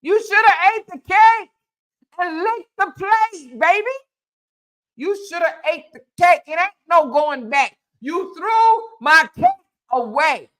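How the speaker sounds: sing-song gloat at the one who threw the cake; taunting Cora. Retold or imitated.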